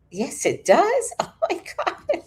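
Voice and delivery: high, laughing amazement; astonished squeal breaking into laughter; laughing disbelief. Real, not acted. Reader learning the book has the phrase, laughing in shock. Reacting to viewers' confirmation, laughing.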